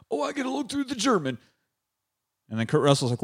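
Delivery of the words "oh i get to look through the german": eager sidekick. Acted. Mocking eager character.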